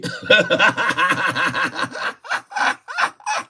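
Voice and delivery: long, high-pitched helpless laugh; real, uncontrolled cackling fit; hard laughter. Real, not acted. Streamer cracking up at own baloney joke, hard laughter. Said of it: "I laughed so hard just then".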